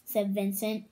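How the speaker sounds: usual speaking voice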